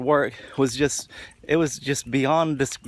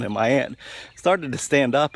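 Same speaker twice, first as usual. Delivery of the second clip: ordinary talk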